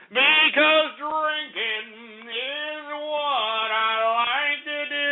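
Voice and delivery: very slow, stretched, mock-tipsy; drawn-out drunken boast. Host joking to listeners about his love of drinking, drawn out and mock-tipsy. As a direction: drag it out like a happy drunk.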